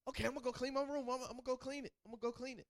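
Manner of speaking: put-on high, fast childish eager voice; a kid rushing to agree for a treat. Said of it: "they start agreeing".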